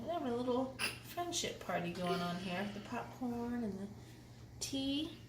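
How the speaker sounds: hushed, cozy aside; soft aside. Real, not acted. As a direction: lean in and say it softly.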